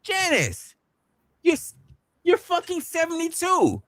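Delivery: high, incredulous doctor voice. Retold or imitated. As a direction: play the doctor as shocked and high. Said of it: "her doctor talking about".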